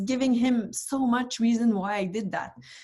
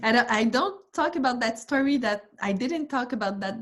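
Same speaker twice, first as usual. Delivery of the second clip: normal talking voice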